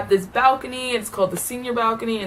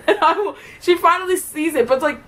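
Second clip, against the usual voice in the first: usual speaking voice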